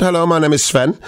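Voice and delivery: put-on German accent. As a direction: read it like a German caller. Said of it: "I'm allowed to do the German accent".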